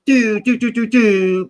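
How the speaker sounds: high, loud mimicked horn toots "do, do, do"; imitated horn. Mimicking the looping horn stuck on his muted stream, loud and high. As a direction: toot it out like a looping air horn. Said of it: "that horn that goes".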